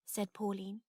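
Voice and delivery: plain reading voice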